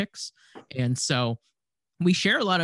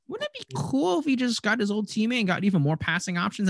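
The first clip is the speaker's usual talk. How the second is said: higher-pitched mocking voice for naive reasoning; mock wishful thinking; 8 semitones higher than usual. Host voices the team wanting his old teammate back, mock-wishful. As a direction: imitate someone floating a silly idea.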